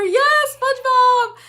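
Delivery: high, drawn-out gleeful yes; excited cheer. Real, not acted. Cheers a commenter's song reference.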